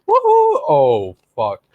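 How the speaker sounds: whoop collapsing into dread. Retold or imitated. Friend mimicking rider's regret after jumping, excited-to-dismayed voice. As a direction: start thrilled, then plunge in pitch to dismay. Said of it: "that was the change in your voice".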